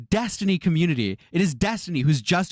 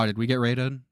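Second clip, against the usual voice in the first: usual speaking voice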